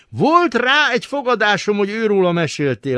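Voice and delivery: high-pitched, gushing excitement; enthusing. A narrator's reading. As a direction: burst out, thrilled to have guessed right. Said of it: "lelkendezett Szűcs Imre".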